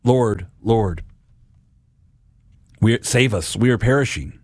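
subdued plea with heavy pauses; understated cry out. Acted. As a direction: cry out to the Lord, but held back. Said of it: "we cry out".

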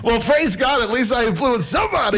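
high, laughing exclamation; laughing relief. Retold or imitated. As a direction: retell your amazed thanks through a laugh. Acting out his amused, thankful reaction.

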